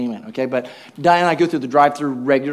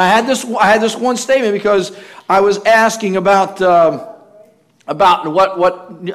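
ordinary talk, nothing marked

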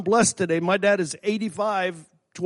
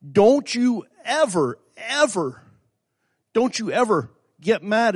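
higher, insistent warning, leaning on each "ever"; emphatic admonition; 5 semitones higher than usual. Real, not acted. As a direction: drive home "don't you ever" with raised pitch. Preacher admonishing the congregation.

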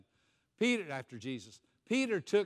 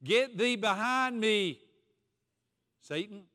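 slow, higher and louder commanding rebuke; stern rebuke; 5 semitones higher, 6 dB louder, slower than usual. Acted. Jesus rebuking Peter to his face, slow stern command. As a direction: drive the words out at Peter. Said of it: "Jesus turned and said to Peter".

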